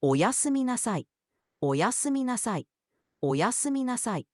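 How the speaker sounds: "Oyasumi nasai" said high, each word clear with a falling end; Japanese good night. Shown as a demonstration. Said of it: "for saying good night in Japanese".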